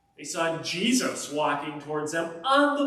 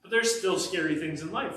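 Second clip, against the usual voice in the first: ordinary delivery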